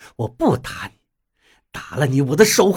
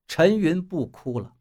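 usual speaking voice

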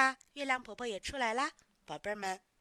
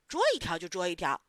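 loud, lower-pitched defiant acceptance; mustering resolve; 5 semitones lower, 7 dB louder, faster than usual. A narrator's reading. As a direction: take the dare, pumping yourself up. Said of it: "鼓着劲儿说".

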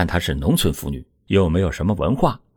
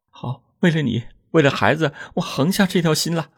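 higher woman's voice, steeling herself; resolute young wife; 8 semitones higher than usual. A narrator's reading. Wife agrees to husband's plan, high and resolved. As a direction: make up your mind.

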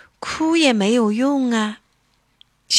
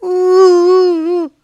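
high, loud acted wail; storybook boo-hoo, drawn out and high; kitten crying; 10 semitones higher, 8 dB louder, slower than usual. A narrator's reading. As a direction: wail a long "wuu" like an upset little one. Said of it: "小猫咪急得哭了".

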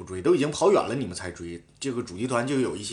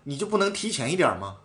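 higher, louder heated retort; retold argument; 6 semitones higher, 3 dB louder than usual. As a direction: argue back with mild heat. (复述在车上与队友争执，语气激动)

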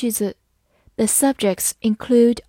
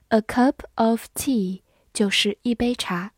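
normal talking voice

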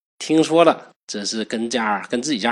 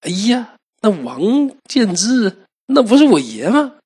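sudden high, loud gasp of disbelief; startled cry rising high; shocked surprise; 9 semitones higher, 5 dB louder than usual. A narrator's reading. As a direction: blurt it out in shock. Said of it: "王成一听大惊".

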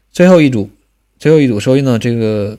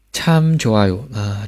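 ordinary delivery

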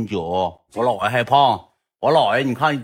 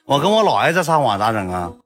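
normal talking voice

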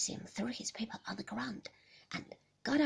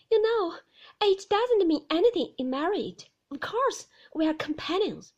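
put-on high, pleading young woman's voice, louder; anxious young wife; 8 semitones higher, 10 dB louder than usual. Young wife pleading with Maxim about visitors, higher and louder. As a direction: plead to be understood, voice lifted high.

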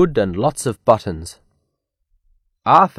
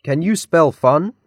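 higher-pitched toy voice asking; talking toy cow; 6 semitones higher than usual. A narrator's reading. Toy cow quizzes the class, high talking-toy voice. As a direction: pipe the question up like a talking toy. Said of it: "said Mary Moo Cow".

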